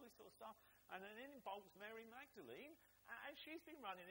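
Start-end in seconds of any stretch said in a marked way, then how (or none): none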